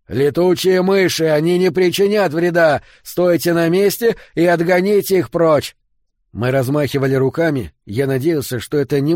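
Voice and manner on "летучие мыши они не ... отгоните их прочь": high, loud commanding bellow; roared orders. A narrator's reading. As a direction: roar it over the panic. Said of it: "Эммет услышал его и проревел". (громкий командный рёв)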